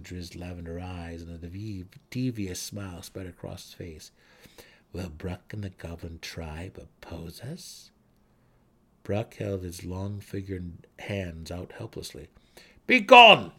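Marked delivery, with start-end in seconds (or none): 4.9-7.9 slow, low menacing drow. Acted. Drow elf taunting goblin chief. 12.9-13.5 loud, high command of a drow; demanding shout. A narrator's reading. Drow warrior ordering the goblins away, loud shout. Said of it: "Driz demanded".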